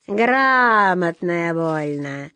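very slow, drawn-out malicious jeer; gloating, lingering taunt; spiteful sneer. A narrator's reading. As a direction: stretch it out with mean mockery. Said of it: "злобно усмехнулась чёрная кошка".

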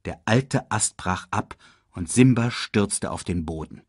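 usual speaking voice

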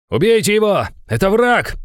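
loud, high-pitched yell; shouted command. A narrator's reading. Commander ordering fighters to kill the enemy, shouting. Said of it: "выкрикнул командир".

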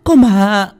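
high, loud panicked cry; terrified shout. Acted. Khoai bursts out shouting to the master in terror. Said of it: "vọt ra ngoài mà hét lên".

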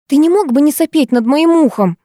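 high-pitched irritated echo of a request; mimicking retort. A narrator's reading. Girl mocking boy's polite request, irritated. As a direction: toss the complaint straight back. Parroting his request back.

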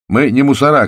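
loud, high indignant shout. A narrator's reading. Volin shouts at the bandit outside, loud and indignant. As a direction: yell it back at the man outside. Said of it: "крикнул Волин".

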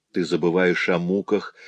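plain reading voice

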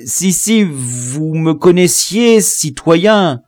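high, halting, drawn-out plea; high-voiced, flustered stammer. A narrator's reading. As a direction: stumble into another desperate explanation. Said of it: "il se mit à bégayer une nouvelle explication".

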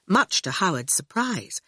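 ordinary delivery, nothing marked

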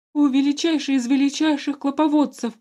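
high loud terrified grovelling. A narrator's reading. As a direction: grovel in panic before the ruler. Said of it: "с ужасом на лице заговорил".

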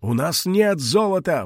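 put-on old man pleading in despair; desperate cry. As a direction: cry out нет with panic. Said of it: "в отчаянии закричал старик".

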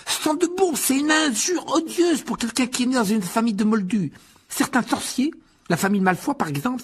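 very high, strained, outraged youngster; indignant boy. A narrator's reading. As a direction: explain a vile insult in a high, worked-up voice. Voices indignant sick boy Ron. (garçon indigné, voix très aiguë)

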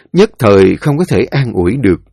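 ordinary delivery, nothing marked

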